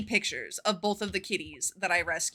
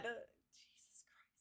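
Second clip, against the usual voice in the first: whisper; 26 dB quieter, much slower than usual. Real, not acted. After the close call.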